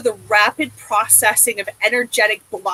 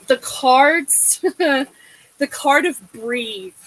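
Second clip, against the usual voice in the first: ordinary delivery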